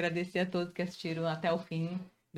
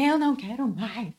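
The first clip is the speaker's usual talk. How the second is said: whiny complaint; 5 semitones higher, 5 dB louder than usual. Retold or imitated. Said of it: "às vezes eu falo pra ele".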